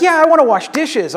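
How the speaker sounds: high, loud imitation of a small child; insistent little kid. Little girl confirming her dream to pastor, imitated insistence. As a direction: pipe up proudly like a kid.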